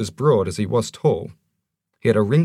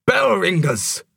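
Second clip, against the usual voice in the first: slow, much higher roar; 10 semitones higher, slower than usual. Acted. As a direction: bellow it out slowly. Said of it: "roared the dwarf".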